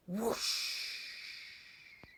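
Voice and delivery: whoosh. Acted. Broomstick takes off.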